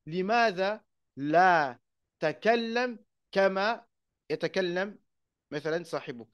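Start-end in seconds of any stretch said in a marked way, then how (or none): 0.1-5.0 Limadha la takallam slowly, one ta dropped; short form quoted. Shown as a demonstration. Teacher quotes Aisha's shortened verb, slow. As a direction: drawl Limadha then clip takallam. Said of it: "elle disait".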